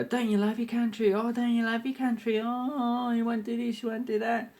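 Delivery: mocking nagging patriots. Mocking people who question his patriotism, high and whiny. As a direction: whine it like a preachy patriot. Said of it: "people go".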